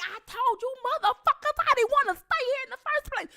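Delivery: high mock cussing rant; cussing out family over roaches. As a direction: imitate herself cussing out the family. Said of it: "I was like".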